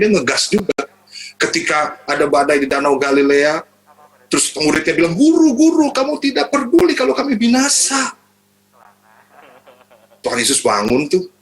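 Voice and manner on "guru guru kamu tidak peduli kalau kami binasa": frightened disciples crying out to their teacher; panicked cry. Acted. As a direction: shout in panic as the boat sinks.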